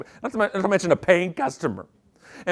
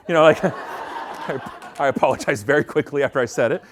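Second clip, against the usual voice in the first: ordinary talk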